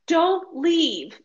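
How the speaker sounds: loud, high desperate plea; urgent plea. Retold or imitated. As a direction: beg the guide not to go. Pleading with tour guide by phone.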